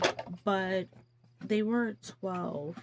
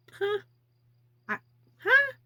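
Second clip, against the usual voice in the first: playful mock innocence; 13 semitones higher, 10 dB louder than usual. Playing dumb to husband.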